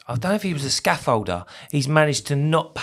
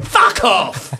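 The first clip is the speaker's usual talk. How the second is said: loud, higher-pitched outburst; exasperated shout; 5 semitones higher, 7 dB louder than usual. Real, not acted. Host snapping at a comment mocking his accent, joking shout. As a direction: burst out with it, then laugh.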